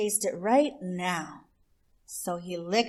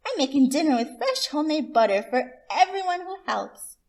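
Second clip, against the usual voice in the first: higher, coaxing offer; sweet possum voice; 8 semitones higher, 4 dB louder than usual. Acted. Miss Possum tempting Rabbit with dinner, high and sweet. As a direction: dangle the treat sweetly up high.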